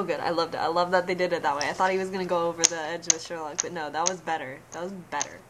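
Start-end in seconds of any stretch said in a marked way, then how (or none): none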